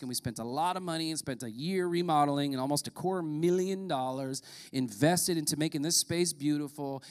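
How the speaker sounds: normal talking voice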